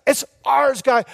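high-pitched, eager rallying speech; inspiring rally. Retold or imitated. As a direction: fire up a doubting crew. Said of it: "he's inspiring them".

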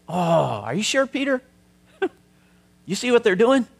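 high, incredulous voice with a laugh; mock the doubters, pitch way up in disbelief; skeptical listener. Preacher imitating a doubter asking Peter.

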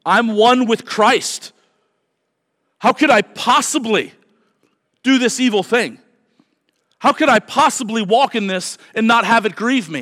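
believer's anguished question, loud; earnest plea. Acted. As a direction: cry out as the grieved believer. Preacher voices the believer's plea.

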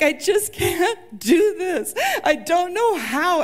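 high, slow, tearful breaking voice; bawling. Retold or imitated. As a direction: let the words crack as the tears come. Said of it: "I am bawling".

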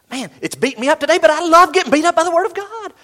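loud, high-pitched enthusiastic outburst; excited testimony. Acted. Pastor voicing listeners stirred by God's word, excited. Voices a thrilled listener's response.

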